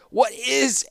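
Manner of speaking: high, pained gasp of a question. Acted. Tortured prisoner questioning his captor, gasping. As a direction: gasp it out after a shock. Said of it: "Tanos gasped".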